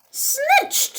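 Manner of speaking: high, crackling ancient crone cry; ancient woman cry. Acted. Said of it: "she cried in a seven hundred-year-old voice".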